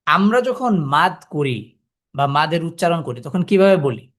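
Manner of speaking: ordinary talk, nothing marked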